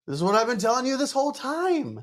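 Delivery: high-pitched, insistent protest; exasperated retort. Retold or imitated. Doer answering pissed-off friends, exasperated retort. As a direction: protest that they were warned all along.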